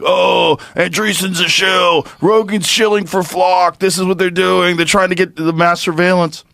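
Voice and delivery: shrieking listener shouting shill; mocking conspiracy listeners. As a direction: whip it up like a conspiracy nut. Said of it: "a group of people listening to this right now saying".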